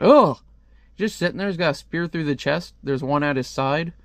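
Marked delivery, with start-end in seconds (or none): 0.0-0.4 loud, raised "ugh"; startled grunt. Acted. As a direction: imitate a character jolting with a grunt. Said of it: "And he's like".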